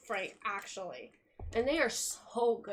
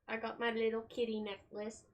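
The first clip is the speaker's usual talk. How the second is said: usual speaking voice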